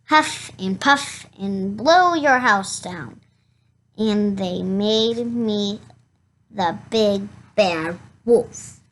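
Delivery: ordinary delivery, nothing marked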